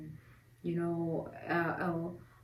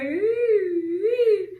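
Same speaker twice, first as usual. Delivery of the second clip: high, rising wail; hammed-up bawling on drawn-out notes; mock crying; 11 semitones higher, 6 dB louder than usual. Crying instead of praising at twin news, mock wail. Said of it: "So I start crying".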